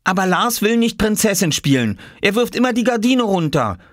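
put-on high-pitched boy's protest; complaining child character. Boy complains to mother about his brother, childish protest.

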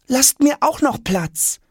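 high, slow pleading request; asking. A narrator's reading. Boy asking the luggage to leave room. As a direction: ask them to leave room. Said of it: "bittet Jonas".

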